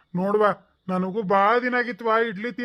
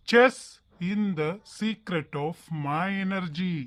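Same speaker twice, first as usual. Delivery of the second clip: slow grand pronouncement; 3 semitones lower, 4 dB quieter, much slower than usual. Acted. Repeats secret, drawn out.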